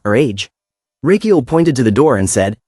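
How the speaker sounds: plain reading voice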